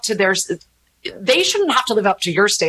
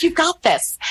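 encouraging friends; 9 semitones higher than usual. Retold or imitated. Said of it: "And, like".

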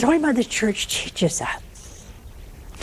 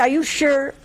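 doubtful husband; 4 semitones higher than usual. Retold or imitated. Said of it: "He said".

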